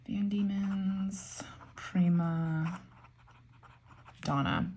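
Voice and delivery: slow whispered reading of an ink name. Real, not acted. Writing the ink name on paper, slow whisper. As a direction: mouth the name softly while writing it out.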